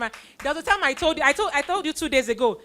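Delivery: normal talking voice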